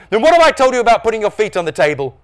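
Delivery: high, loud telling-off; exasperated scolding. A narrator's reading. Dad telling Norm off, exasperated. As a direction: scold like a dad losing patience. Stressed dad tells son off.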